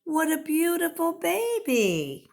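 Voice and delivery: father bird thrilled with his chick; delighted. Acted. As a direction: gush over the new baby. Proud father admires chick.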